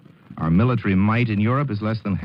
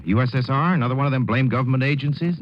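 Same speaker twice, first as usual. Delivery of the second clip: higher, fast blustering senator; 7 semitones higher, faster than usual. A narrator's reading. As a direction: rattle it off in righteous outrage. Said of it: "Ferguson filibuster takes the floor".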